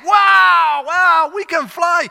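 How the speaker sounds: hammed-up ecstatic yelling; ecstatic shout. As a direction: shout in giddy delight. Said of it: "They're ecstatic".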